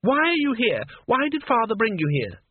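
put-on high-pitched angry girl demanding answers; acted indignant explosion, pitch way up; exasperated outburst. A narrator's reading. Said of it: "She exploded".

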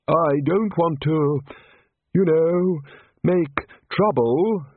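put-on high, slow wistful old wizard. A narrator's reading. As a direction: plead gently, slow and high. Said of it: "looking wistfully at the sun-drenched beach".

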